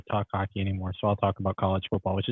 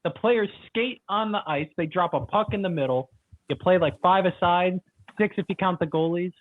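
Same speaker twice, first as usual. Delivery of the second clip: mock explainer; 8 semitones higher than usual. Over-explains hockey rules mockingly.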